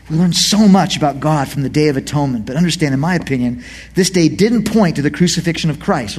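normal talking voice

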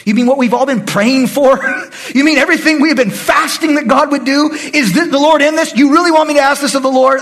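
can't believe what he's being asked; incredulous priest. Retold or imitated. Preacher voicing the priest, amazed at Saul's request.

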